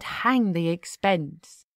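plain reading voice

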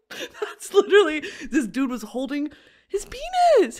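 high-pitched, laughing through the words; helpless giggly squeal. Real, not acted. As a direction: barely get it out for laughing. Cracking up at her own story.

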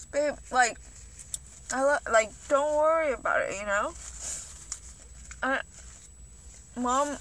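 a hint of mom's accented English, gentle and soothing; mom's broken English. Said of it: "in her broken English".

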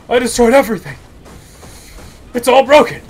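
loud, high crowing; gleeful gloating. Pretend. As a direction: crow triumphantly over the wreckage. Glee at destroying everything.

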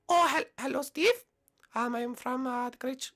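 high, halting attempt at American accent; hesitant put-on American accent. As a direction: try on an American accent in falsetto. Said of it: "I'm trying this American accent".

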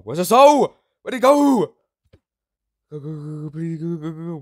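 high, loud frantic search; yelling in panic; panicked shout. Real, not acted. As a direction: shriek where's the soul. Panicking for lost soul.

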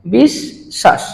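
বিশ্বাস very slow, split bish-shash; doubled শ pronunciation. Shown as a demonstration. Teacher demonstrating how we pronounce it, doubled শ pronunciation. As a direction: stretch বিশ্বাস into two halves, both শ sounded. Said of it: "আমরা কিভাবে উচ্চারণ করছি".

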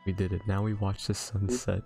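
ordinary talk, nothing marked

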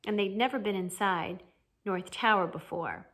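ordinary delivery

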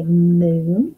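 moon with a long held "mmm"; stretched first sound. Shown as a demonstration. Teacher asking kids to say it, long M in moon. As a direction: hum on the M before finishing moon. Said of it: "What's the first sound you hear".

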